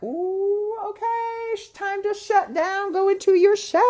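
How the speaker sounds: very high, tight mock panic voice; high panicky squeak. To herself when someone yells, a high squeaky panic. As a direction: squeak it out like shrinking away. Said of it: "and I was like".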